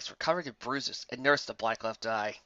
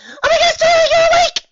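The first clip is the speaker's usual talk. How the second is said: much higher and louder squeal; joyful squeal; 17 dB louder than usual. Acted. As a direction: burst with bouncy delight. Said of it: "Pinkie squealed with joy".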